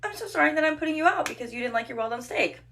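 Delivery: exasperated fake sorry; sarcastic apology. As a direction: apologize pointedly, not meaning it. Sarcastic mock apology to huffy customer.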